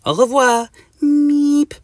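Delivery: high French farewell with a meep; very high, loud meepy insect; Mister Mosquito. Acted. Mosquito flies off, high meepy goodbye. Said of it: "in a meepy voice".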